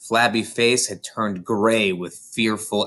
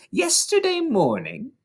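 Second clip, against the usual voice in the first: higher, ranting headmistress voice; tyrannical headmistress; 6 semitones higher than usual. A narrator's reading. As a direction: rage at the boy like a tyrant. Headmistress rants at accused boy.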